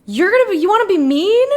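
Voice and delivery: high, loud, rising question; incredulous question. Real, not acted. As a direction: ask it high and loud in disbelief. Teasing chat about being mean.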